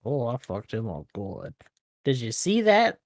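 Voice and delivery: ordinary talk, nothing marked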